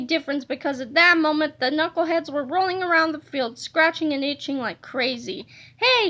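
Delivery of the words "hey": higher, shouted hey; coach's shout. Acted. Angry coach yelling across the field, higher and shouted. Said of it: "shouted the coach".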